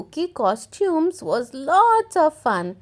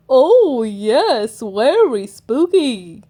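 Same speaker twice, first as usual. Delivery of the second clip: grinning grandpa; 5 dB louder than usual. Acted. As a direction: agree slyly like a grandad. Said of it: "Grandpa Pig grinned".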